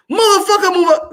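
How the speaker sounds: high, loud curse and order; mock cursing shout. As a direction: snap it up high at them. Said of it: "if you say".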